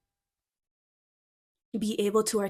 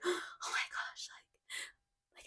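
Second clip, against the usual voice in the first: higher, hushed, slow whisper of amazement; awed whisper; 10 semitones higher, 12 dB quieter, much slower than usual. Retold or imitated. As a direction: breathe it out like a starstruck kid.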